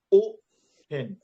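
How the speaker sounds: O high, pen low in open; stress on first syllable. Shown as a demonstration. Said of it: "O is high, then pen is low".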